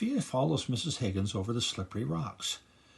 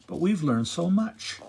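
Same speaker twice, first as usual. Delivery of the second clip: exclaiming; 6 semitones higher, 7 dB louder than usual. A narrator's reading. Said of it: "Princess June exclaims".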